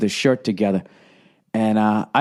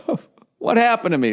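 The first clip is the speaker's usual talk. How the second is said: higher, half-hearted fake whimper; mock crying; 9 semitones higher than usual. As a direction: pretend to tear up, pitched high. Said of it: "makes you wanna start crying".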